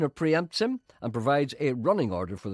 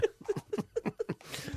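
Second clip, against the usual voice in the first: high laugh; 6 dB quieter than usual. Real, not acted. Laughs at own joke.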